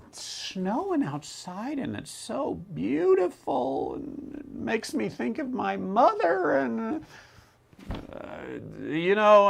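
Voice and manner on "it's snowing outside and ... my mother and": high, soft, drawn-out mock weepy voice; mock crying. Sentimental person crying over beautiful snow, mock tearful. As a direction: fake being moved to tears, high and slow. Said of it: "people who cry because".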